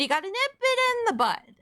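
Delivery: high, chirpy cartoon voice; Pinkie Pie. Real, not acted. Streamer slipping into a cartoon voice, high and peppy. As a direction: pitch it way up, perky and bouncy. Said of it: "I said it like fucking Pinkie Pie".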